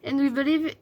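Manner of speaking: English read in a heavy French accent; deliberately bad French accent. Shown as a demonstration. Finishing the notebook's English motto for viewers, bad French accent. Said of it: "Avec mon bel accent anglais".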